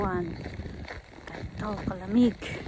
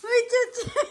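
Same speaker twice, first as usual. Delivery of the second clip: much higher sing-song call to the dog; high playful call; 12 semitones higher than usual. Real, not acted.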